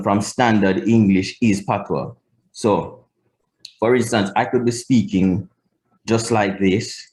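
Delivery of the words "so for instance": usual speaking voice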